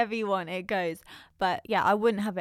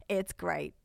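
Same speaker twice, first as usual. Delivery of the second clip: deadpan verdict, a little softer; imitating brother's tone; 5 dB quieter than usual. Sister mimicking brother's ending to his food list, deadpan. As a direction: mimic an understated sign-off. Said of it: "Just in that exact tone as well".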